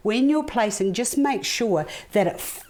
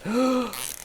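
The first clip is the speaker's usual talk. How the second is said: gasp; 5 semitones higher than usual. Real, not acted. Releases held breath.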